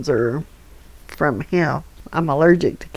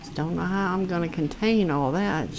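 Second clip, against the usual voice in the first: usual speaking voice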